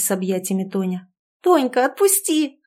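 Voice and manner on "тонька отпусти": high squealing protest; higher, louder squeal. A narrator's reading. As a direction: squeal to be let go. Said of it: "взвизгнула подруга".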